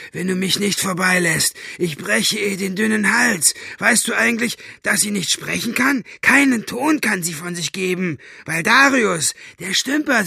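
high, spiteful villain voice; malicious gloating, pitched high; Buster, gloating. A narrator's reading. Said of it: "mit hämischer Stimme".

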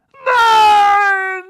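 very high, loud drawn-out cry; desperate cry. A narrator's reading. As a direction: cry out in despair. Vernon cries out.

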